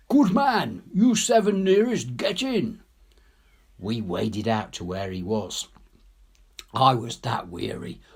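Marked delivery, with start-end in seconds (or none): 0.1-2.8 higher, louder officer's order; officer's shout. Acted. Officer orders the seven nearest men into the boat, loud shout. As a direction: bark the order down the beach. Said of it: "Our officer shouts".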